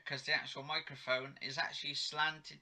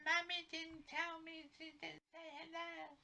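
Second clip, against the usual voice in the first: put-on whiny little girl; 8 dB quieter than usual. Little girl about her mum, high whiny child voice.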